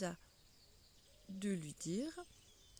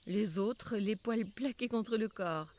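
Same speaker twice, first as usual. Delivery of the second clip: ordinary delivery, nothing marked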